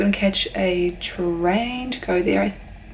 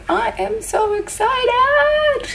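high, slow squeal held back; bursting excitement; 12 semitones higher, much slower than usual. Real, not acted. Vlogger squealing to viewers about going to Tokyo. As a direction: stretch out the excitement, pitched high. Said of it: "trying not to talk too loud".

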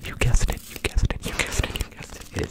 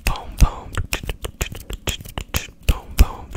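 loud whispered boom sounds; punchy whispered trigger word; 4 dB louder, slower than usual. Acted.

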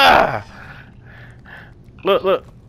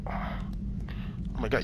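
shocked whisper; 6 semitones lower, 16 dB quieter, much slower than usual. Real, not acted. Gnome jumps into fire.

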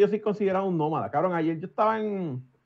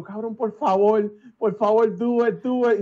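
louder, slow pleading; begging; 3 semitones higher, 5 dB louder than usual. Retold or imitated. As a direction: plead with him to say it. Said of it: "yo lo vi ayer y yo". (suplicando con insistencia)

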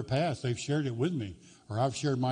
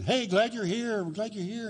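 cheery greeter; 7 semitones higher than usual. Retold or imitated. Greeter's fake welcome voice.

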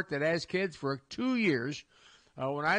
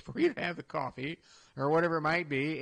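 ordinary talk, nothing marked

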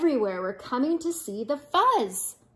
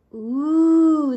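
loud, raised, drawn-out ooh; awed gasp; 4 semitones higher, 8 dB louder than usual. Acted. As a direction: marvel like a whole crowd. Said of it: "the crowd gasped".

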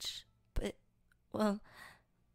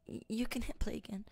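whisper. Acted. Whispered ASMR roleplay.

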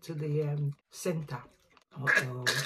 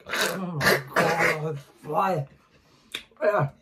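slow, pained groan at the sourness; eating sour candy; 6 dB louder, much slower than usual. Real, not acted. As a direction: drag it out, suffering the sour taste.

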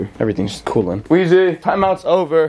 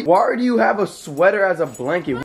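usual speaking voice

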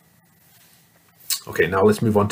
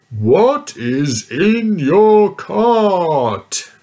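slow, loud, higher giant bellow; mean giant yelling at the little girl; 10 semitones higher, 8 dB louder, much slower than usual. Acted. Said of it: "yelled the mean giant".

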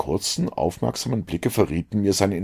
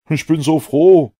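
bursts out, louder and higher, with joy; delighted exclamation; 10 semitones higher, 10 dB louder than usual. A narrator's reading. Mortimer spots his stick and exclaims in delight. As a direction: cry out gladly on spotting the lost stick. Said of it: "mit erfreutem Ausruf".

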